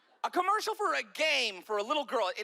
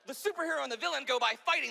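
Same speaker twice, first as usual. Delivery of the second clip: usual speaking voice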